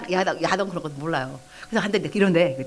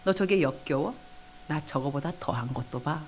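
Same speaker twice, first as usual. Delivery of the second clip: quiet question, sinking lower and softer; Holy Spirit; 5 semitones lower, 8 dB quieter than usual. Retold or imitated. Speaking to a disgusted believer at prayer, quiet sober reply. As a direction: let the answer fall low and soft. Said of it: "그 다음 말씀".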